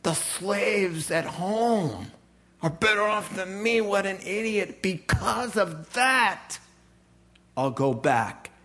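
slow, lightly voiced hungry son; selfish son's thoughts. The son in the pig pen reasons slowly, lightly voiced. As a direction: mull it over slowly, self-pitying. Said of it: "He said".

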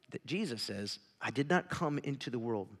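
ordinary talk, nothing marked

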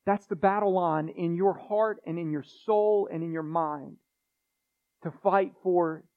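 ordinary delivery

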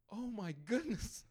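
high, loud, slow retold amazement; really excited. Healed lady testing her hip, really excited. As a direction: act out her thrilled astonishment, drawn out. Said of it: "like, really excited".

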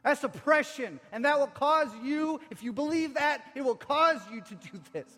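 high, loud, emphatic denunciation; preaching. Acted. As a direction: preach it forcefully against oppression. Preaches at full force.

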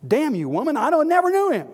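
furious, cursing shout; Peter. Acted. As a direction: explode into a high, loud denial. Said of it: "He took a curse and said".

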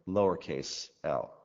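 ordinary talk

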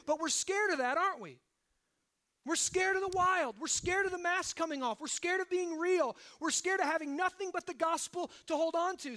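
loud, high, driving preacher cry; preaching at full force. Acted. Preacher to congregation about fear of the wild, loud and urgent. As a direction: hammer each line home from the pulpit.

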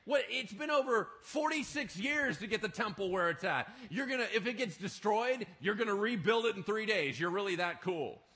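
loud and high, scoffing with a laugh; mock the claim as ridiculous; mocking disbelief. Crowd mocking Jesus' claim.

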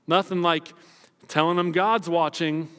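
normal talking voice

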